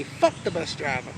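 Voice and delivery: low, muttered "Fuck the bus driver."; muttered curse. Retold or imitated. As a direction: say "Fuck the bus driver." under your breath, almost to yourself. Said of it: "I say".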